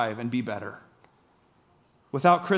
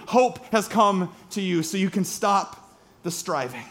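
louder, driving sermon voice; full-force preaching; 3 semitones higher, 3 dB louder than usual. Acted. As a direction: preach it out to the congregation with conviction.

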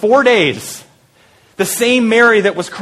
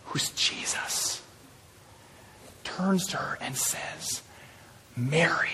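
very soft, near-whispered and unhurried; hushed tender telling; 2 semitones lower, 17 dB quieter, slower than usual. Acted. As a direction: let the name land gently in a hush. Hushed climax, Jesus calls Mary.

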